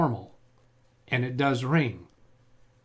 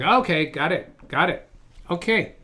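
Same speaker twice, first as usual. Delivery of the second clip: ordinary delivery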